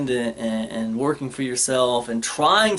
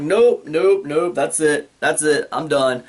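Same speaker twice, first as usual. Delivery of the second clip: louder, hands-up "nope nope" panic; panicked refusal; 4 dB louder, slower than usual. Retold or imitated. Patient stopping the dentist mid-drill, louder and panicked. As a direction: bail out loudly, flat refusal. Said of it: "I'm like".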